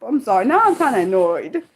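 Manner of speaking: usual speaking voice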